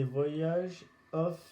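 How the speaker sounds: English title in a heavy French accent, slow; bad English accent. Real, not acted. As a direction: say the English name slowly, with a clumsy French accent. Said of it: "désolé si mon accent anglais est nul". (mauvais accent anglais, à la française)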